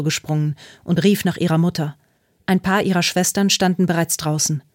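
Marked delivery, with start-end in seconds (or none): none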